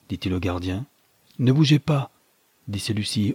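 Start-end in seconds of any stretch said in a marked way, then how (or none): 1.4-2.1 threatening order. A narrator's reading. Guard threatens at gunpoint.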